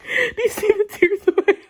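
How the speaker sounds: high wailing laugh-cry; laughing to tears. Real, not acted. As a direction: squeal through tears of laughter. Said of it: "I'm about to start crying".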